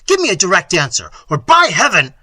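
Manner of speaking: prince's impatient outburst; threatening cry. A narrator's reading. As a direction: threaten them into answering. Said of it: "cried Manfred".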